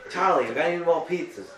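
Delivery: loud, high Brooklyn pizza guy; Brooklyn accent. Pretend. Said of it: "We do a Brooklyn accent".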